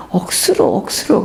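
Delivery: lightly imitating a Gyeongsang pastor saying "억수로"; Gyeongsang dialect. Pastor recalls a Gyeongsang colleague's word, light Gyeongsang accent. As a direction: say "억수로" with a mild Gyeongsang lilt. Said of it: "경상도 말로 억수루라는 말을".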